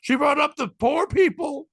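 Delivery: hammed-up outraged complaint, drawn out; upset woman's rant. Comic retelling an upset audience member's complaint, high and loud. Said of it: "She goes".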